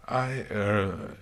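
halting, trailing hesitation; hesitant pause. A narrator's reading. Princess faltering before explaining herself, hesitant pause.

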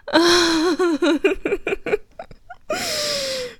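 high, airy laugh of relief; breathless laugh. Acted. After the climax.

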